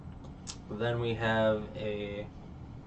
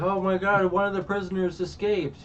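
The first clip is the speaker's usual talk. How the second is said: panicked alarm; 9 semitones higher, 5 dB louder than usual. Retold or imitated. Navy guy panicking about escape.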